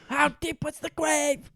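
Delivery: high, strained mock shout at normal volume; squeezed comic imitation of yelling; mock interrogator echo. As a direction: parody the outburst in a high put-on voice. Co-host echoes the shouted line.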